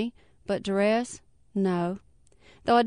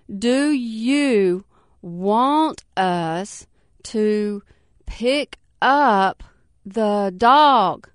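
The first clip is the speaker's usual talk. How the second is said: slow, halting, heavy pauses between words; strange drawn-out question; 4 dB louder, much slower than usual. Acted. Officer slowly hints at duress on the phone, drawn-out and strange. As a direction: stretch it out oddly, as if hinting at something. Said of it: "She sounded very strange".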